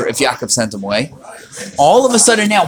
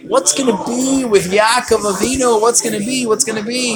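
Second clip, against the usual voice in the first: higher, fretting what's gonna be; worried brothers; 7 semitones higher than usual. Retold or imitated. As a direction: wring your hands, fretting. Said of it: "the brothers are saying".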